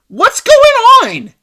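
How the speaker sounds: high, loud yelp that drops at the end; exasperated outburst. Real, not acted. Reviewer fed up with the movie's messaging, exasperated yell. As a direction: cry out in disbelief, pitch shooting up.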